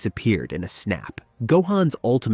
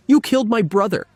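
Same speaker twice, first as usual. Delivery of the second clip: high, loud, fast hateful yell; furious; 12 semitones higher, 5 dB louder, faster than usual. Acted. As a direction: spit it out in rage. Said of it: "Hatred twisted every word".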